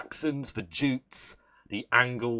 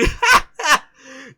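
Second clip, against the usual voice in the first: high burst of laughter; 14 semitones higher, 12 dB louder than usual. Real, not acted. Laughs at doggy.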